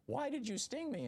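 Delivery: squeaky high frog voice, pleading; lightly voiced dying frog. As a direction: frog crying out in disbelief as he dies. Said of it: "he's saying".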